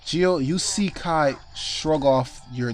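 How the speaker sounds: normal talking voice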